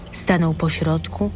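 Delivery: ordinary talk, nothing marked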